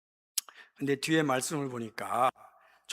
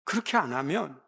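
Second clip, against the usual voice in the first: ordinary delivery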